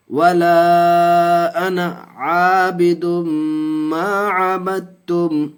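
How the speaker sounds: slow, high, drawn-out chanted recitation; Quranic recitation. Shown as a demonstration. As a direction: intone each syllable long and melodic like tajweed recitation. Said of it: "teacher recites surah".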